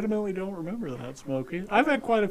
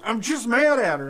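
higher, louder mock exasperation; imitated angry rant; 6 semitones higher, 8 dB louder than usual. Imitating an angry friend venting about his wife, exasperated. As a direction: blurt it like a fed-up husband.

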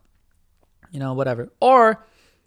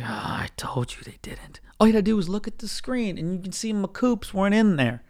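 unvoiced whispered retort; 5 semitones higher, 4 dB quieter than usual. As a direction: whisper it under your breath.

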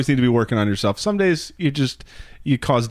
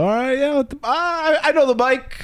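flustered reply; 11 semitones higher than usual. Retold or imitated. Acting out flustered reply to trainer.

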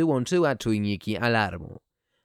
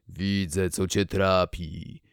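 put-on old speech synthesizer; 4 semitones lower, slower than usual. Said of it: "włączyłem syntezator mowy".

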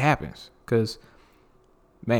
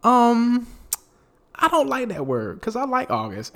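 mimicking friend; 11 semitones higher than usual. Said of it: "she was like".

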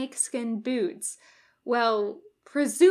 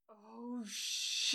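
faint whispered oh shit; a curse heard from far away, breathy and faint; 11 dB quieter than usual. Acted. Sound rushing in from a long way off, as a whispered curse.

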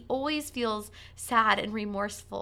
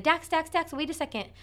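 raised, higher urgent call; calling out to stop; 7 semitones higher than usual. Retold or imitated. Mother calling her son to wait and ask first, raised urgent call. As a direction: call the boy's name to stop him. Mom stopping son jumping on.

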